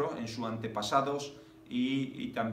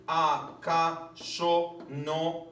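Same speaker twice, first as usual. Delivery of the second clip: slow scansion; 2 semitones higher, much slower than usual. Shown as a demonstration. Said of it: "vamos a ver cuánto tiene".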